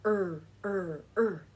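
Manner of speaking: a short buzzing er, er, er; growly er sounds. Shown as a demonstration. Teacher showing kids the rabbit sound, growly er. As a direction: say "Er" like a rabbit's rumble, in bounces. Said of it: "and you go".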